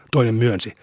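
usual speaking voice

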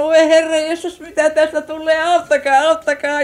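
high, loud panicked pleading; imitated hysteria. Aunt clinging to the police commissioner, imitated hysterical cries for help. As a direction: play a hysterical woman crying for help. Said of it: "Ja oli kauhean hysteerinen ja hermostunut".

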